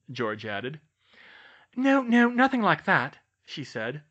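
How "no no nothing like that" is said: put-on high, playful girl voice; young woman voice. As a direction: tease him in a higher voice.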